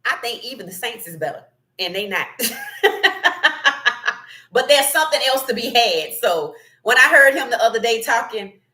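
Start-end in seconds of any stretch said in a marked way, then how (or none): 2.3-4.5 long, high rolling laugh; real drawn-out belly laugh. Real, not acted. Host laughs at own jab.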